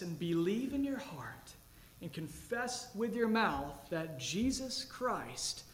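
normal talking voice

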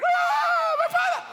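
very high, loud wail; wailing cry, very high; loud cry of anguish. Acted. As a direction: cry out like Jesus on the cross. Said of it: "he cried".

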